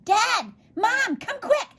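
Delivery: high, urgent yells for help; alarmed shouting. A narrator's reading. Boy calling his parents to see the thing, high alarmed shout. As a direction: shout very high, stretching out the last phrase. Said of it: "Overy was shouting".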